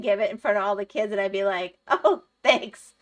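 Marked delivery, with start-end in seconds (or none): 1.9-2.9 loud, higher-pitched fake thanks; mock gratitude. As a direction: act out thanking someone for an unwanted gift. Acts out her reluctant public thanks.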